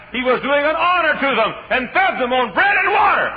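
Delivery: loud, high, rising sermon cry; fiery preaching. Acted. Preacher booming the story of Obadiah to the church. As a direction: preach it out to the congregation at full force.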